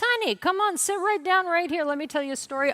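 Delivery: high, sweet coaxing voice; husband's coaxing. Retold or imitated. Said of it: "My husband, he comes up to me and says".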